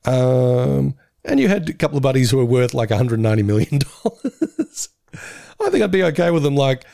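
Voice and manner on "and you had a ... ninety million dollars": ordinary talk, nothing marked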